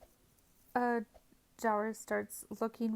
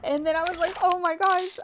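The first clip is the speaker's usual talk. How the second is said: loud and much higher, breathless with excitement; excited shouting; 9 semitones higher, 11 dB louder than usual. Real, not acted. Telling the near-death shootout, excited shout. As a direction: relive the shootout at full volume. Shootout, thought she was dead.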